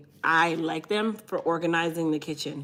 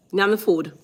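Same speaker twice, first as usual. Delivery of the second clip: pushy auntie; 3 semitones higher, 7 dB louder than usual. Pretend. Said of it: "You sound like Auntie Palmer now".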